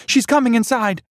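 high, loud terrified warning; panicked cry. A narrator's reading. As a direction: shriek that she is coming. Panicked friend.

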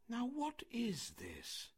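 high, slow, soft puzzlement; bewildered. A narrator's reading. Mowgli puzzled by the flying stones, bewildered. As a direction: draw the question out, baffled.

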